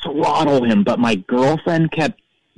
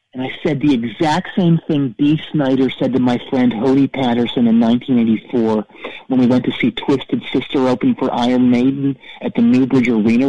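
ordinary talk

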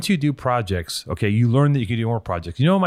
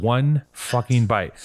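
ordinary delivery

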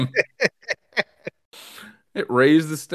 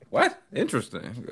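mock surprise; 8 dB quieter than usual. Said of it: "just file like".

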